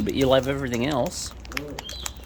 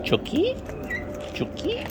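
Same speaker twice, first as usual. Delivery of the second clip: high calling; 4 dB quieter than usual. Real, not acted. Calling pet bird.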